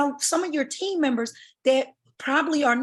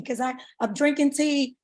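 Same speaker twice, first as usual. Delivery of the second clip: normal talking voice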